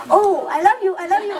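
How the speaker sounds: high, squawky bird voice begging for treats; talking parrot. Retold or imitated. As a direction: coo it like a bird that wants its food. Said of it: "I bring out their favorite food".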